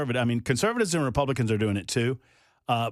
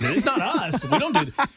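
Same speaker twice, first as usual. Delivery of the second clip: laughing quote; 9 semitones higher, 4 dB louder than usual. Retold or imitated. Retold staffer quote, laughing.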